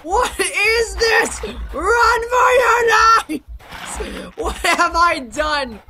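high, slow, drawn-out panicked shout; mock panic. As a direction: yell a hammed-up warning to flee. Mock terror at own creation.

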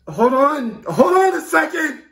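loud, high-pitched panic; frantic interruption. Acted. Caller overwhelmed by news of the crash, frantic interruption. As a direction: cut in, overwhelmed and pleading.

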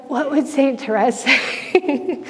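high, drawn-out whimper with a laugh; mock tearful question. Retold question to the priest, mock tearful. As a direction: ask slowly in a hammed-up pleading voice.

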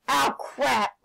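ordinary delivery

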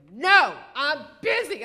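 loud, high, drawn-out mock snap; irritated refusal. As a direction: imitate a busy woman refusing sharply. Busy woman snaps a refusal.

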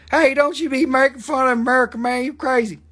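high-pitched hammed-up American accent; mock American accent. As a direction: play an indignant American in a put-on accent. Mock American defending "Merca.

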